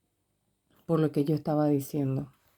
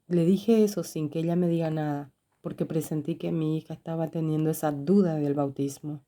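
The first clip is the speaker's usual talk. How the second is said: usual speaking voice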